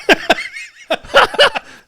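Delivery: high, loud cackling burst of laughter; real belly laugh. Real, not acted. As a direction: let out a big high cackle.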